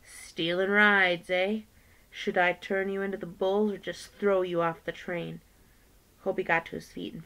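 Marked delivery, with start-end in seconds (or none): 0.0-5.4 menacing threat. Acted. Brakeman threatens the kids he caught stealing rides, menacing and loud. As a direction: lean on each word like a bully. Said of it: "he advanced on them menacingly".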